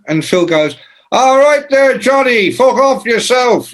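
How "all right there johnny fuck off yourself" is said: high, loud mock brush-off. [name] telling the horrible bloke to fuck off, high and loud. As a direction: tell him to get lost, louder and higher.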